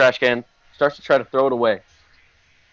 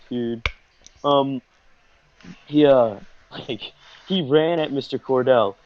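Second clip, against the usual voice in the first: normal talking voice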